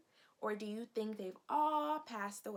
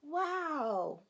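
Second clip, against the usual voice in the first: wow; 5 semitones higher, 4 dB louder than usual. Real, not acted. Amazed at book picture.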